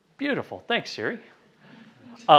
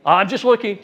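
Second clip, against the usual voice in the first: higher, louder brush-off; mock brush-off; 6 semitones higher, 7 dB louder than usual. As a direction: toss it off like a wary buyer. Prospect's stock objection.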